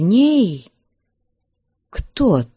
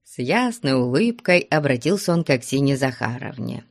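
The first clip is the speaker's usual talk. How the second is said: ordinary delivery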